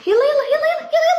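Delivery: urgent coaxing. Real, not acted. Urging the pet on.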